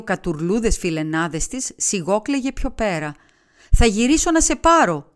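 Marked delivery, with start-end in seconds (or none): 3.8-5.1 called out high and loud; shouted promise. A narrator's reading. Young man calling to his girlfriend from the departing crowd, shouted. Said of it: "της φώναξε".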